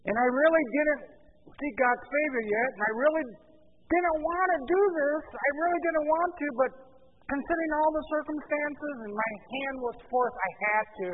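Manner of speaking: high, loud, pleading self-justification; excuse-making. Acted. Saul excuses himself to Samuel, pleading defensively. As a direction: plead reluctance, overacting the excuse. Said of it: "he makes one excuse after another".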